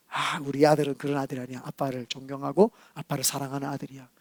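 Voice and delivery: soft, low fatherly musing; quietly insist, with tender trust; fond reassurance. Acted. Father believing in his son who loves him, fond reassurance.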